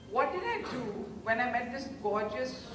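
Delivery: usual speaking voice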